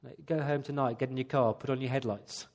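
ordinary delivery

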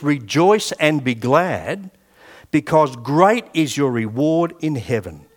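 slow, deliberate Bible reading; measured pulpit reading, drawn-out stressed words; scripture reading. Acted. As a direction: read each phrase out weightily, with pauses. Reads scripture aloud to the congregation.

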